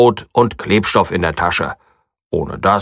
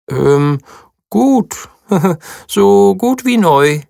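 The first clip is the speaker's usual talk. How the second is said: high, halting forced praise; stall, then force out a compliment; a mouse hesitantly fibbing to a friend; 9 semitones higher, slower than usual. A narrator's reading. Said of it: "meint sie zögerlich".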